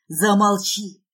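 loud, angry command; harsh, loud man's order; growled. Acted. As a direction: snarl it out forcefully. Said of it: "рычит он".

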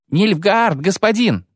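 high, alarmed soldier's cry; panicked shout. A narrator's reading. Soldier warns his lord of Nilfgaard, crying out. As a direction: cry the warning, pitched high. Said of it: "крикнул кнехт".

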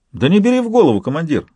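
high-pitched, breezy exclamation; exclaiming. A narrator's reading. Soldier brushing off his commander's worry, exclaiming. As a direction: call it out, pitch leaping up. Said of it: "воскликнул Солейка".

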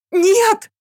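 high desperate loud shriek. A narrator's reading. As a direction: scream it with last strength. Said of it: "взвизгнула Яна из последних сил".

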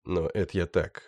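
usual speaking voice

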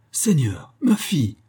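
put-on old man's high exclamation, leaping up; shocked cry. A narrator's reading. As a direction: cry out in dismay. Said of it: "s'écria le vieillard".

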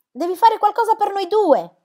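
put-on girl screaming her plea to a mother who does not answer; desperate shout. Searching house for silent mother.

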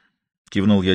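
ordinary delivery, nothing marked

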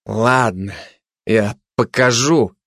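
hesitant, slow concession; subdued, baffled agreement; puzzled, giving in. Acted. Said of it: "проговорил озадаченный предатель".

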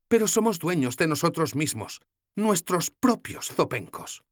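voiced young heroine, defiant; proud young woman. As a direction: answer the goddess proudly. Sefawin voiced.

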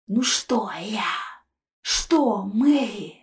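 mock him back; jeering ghost whisper. A narrator's reading. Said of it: "с издёвкой спросил призрак".

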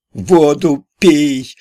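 high, loud, slow squeal; shrieked. A narrator's reading. The manager shrieks at Vorobey to drink water, high loud squeal. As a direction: squeal it in alarm. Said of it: "завизжал заведующий".

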